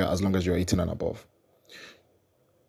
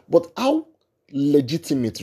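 incredulous; 5 semitones higher, 3 dB louder than usual. Real, not acted. Rhetorical outburst.

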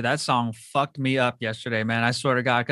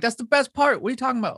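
put-on excited kid self; 9 semitones higher than usual. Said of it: "I'm like".

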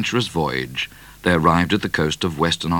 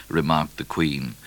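ordinary delivery, nothing marked

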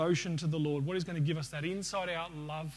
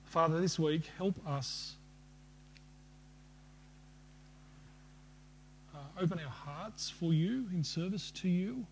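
closing prayer. Acted. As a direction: pray over the congregation unhurriedly.

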